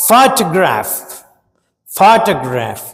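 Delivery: Photograph with the weight on ఫో, slow and clear; stress on first syllable. Shown as a demonstration. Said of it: "stress pattern మొదట్లోనే ఉంది". (Photograph లో మొదటి syllable మీద ఒత్తి పలకండి)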